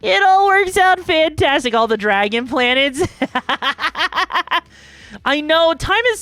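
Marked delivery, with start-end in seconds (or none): 0.0-1.7 pitched-up, punchy cheer; exuberant repeat. Real, not acted. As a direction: belt it out higher with gleeful enthusiasm. Repeats the line more exuberantly.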